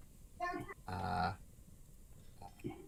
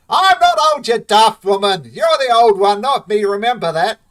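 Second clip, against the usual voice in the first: indignant yell; 11 semitones higher, 17 dB louder than usual. Acted. Brother bickering loudly.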